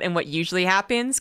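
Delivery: ordinary talk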